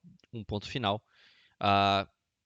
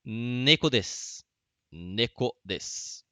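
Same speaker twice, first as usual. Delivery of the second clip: very slow, syllable by syllable; slow model phrase; much slower than usual. Shown as a demonstration. Teacher slowly modelling a Japanese sentence for students. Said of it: "Mais um exemplo".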